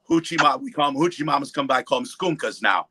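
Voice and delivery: ordinary talk, nothing marked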